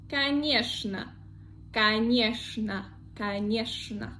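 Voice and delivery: конечно as ka-NYESH-na. Shown as a demonstration. Teacher drilling a popular exception, конечно as ka-NYESH-na. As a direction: say конечно with a clear "sh" before the final "na". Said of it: "in reality it is sh".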